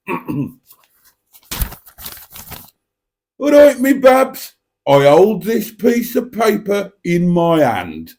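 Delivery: high, slow, loud put-on old statesman voice, then settling; Neville Chamberlain impression. Streamer hams up Neville Chamberlain for chat, loud and slow. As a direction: ham up a pompous prewar prime minister waving his paper. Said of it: "that was Neville Chamberlain".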